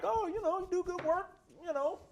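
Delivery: high, loud movie-character quote; hammed-up high-pitched character line; acted movie character. Acting Lionel in a movie recap.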